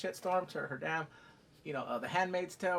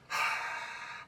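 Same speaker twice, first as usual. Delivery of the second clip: long voiceless exhale; mock dragon breath. Magical baby doing dracarys, long breathy blast. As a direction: breathe out fire in one long huff.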